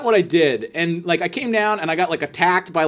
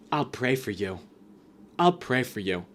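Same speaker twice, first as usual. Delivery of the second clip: softer, mock-sanctimonious murmur; mocking pious voice; 4 semitones lower, 8 dB quieter than usual. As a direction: parody the churchy kid's smug blessing. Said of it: "he's like".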